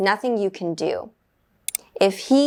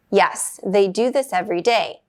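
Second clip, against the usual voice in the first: ordinary talk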